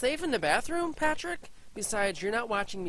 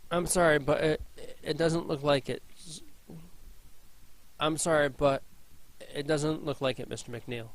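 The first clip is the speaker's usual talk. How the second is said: somber apology; 7 semitones lower, 3 dB quieter than usual. Acted. Breaking news of death gently.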